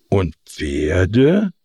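mildly fearful peasants' question; anxious. A narrator's reading. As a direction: ask as if dreading the answer. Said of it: "fragten ängstlich die Bauern".

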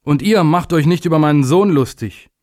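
high, sharp scolding call; venomous warning. A narrator's reading. Father defending his son to the assembled days, venomous. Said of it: "rief er giftig den versammelten Tagen zu".

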